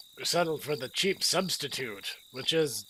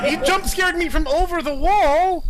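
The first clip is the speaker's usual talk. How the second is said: high, loud outraged after a fright; scared horror game streamer; 13 semitones higher, 8 dB louder than usual. Real, not acted. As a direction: yell at the game, shaken. Jump scared by game enemy.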